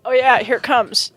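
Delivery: usual speaking voice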